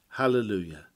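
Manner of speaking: ordinary talk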